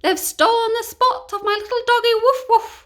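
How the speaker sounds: high, loud queen's cry; queen yelling at the thieves, high and loud; royal cry. Acted. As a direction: shriek like an outraged queen. The queen goes on.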